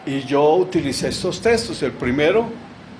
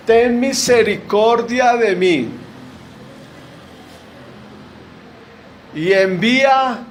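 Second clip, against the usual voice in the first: slower, louder, higher plea for mercy; pleading cry; 6 semitones higher, 4 dB louder, slower than usual. Acted. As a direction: beg aloud for mercy from hell. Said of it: "Al decir". (súplica en voz alta y lenta)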